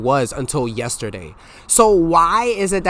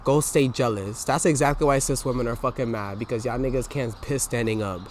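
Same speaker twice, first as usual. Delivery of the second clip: ordinary talk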